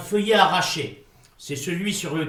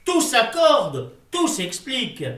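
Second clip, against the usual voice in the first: triumphant realization; 8 semitones higher, 5 dB louder than usual. Acted. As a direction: announce each clause as proof. Sudden revelation.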